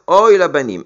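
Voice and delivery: plus fort et plus aigu, comme un lion qui rugit; rugissement plaintif. Acted. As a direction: lance le Oy comme une lamentation criée. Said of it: "il rugit comme un lion". (Dieu se lamente sur ses enfants, d'une voix forte et rugissante)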